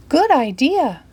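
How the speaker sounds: high, pleased granny voice; lightly voiced granny; Granny agrees. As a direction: chirp it out brightly. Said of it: "Granny said".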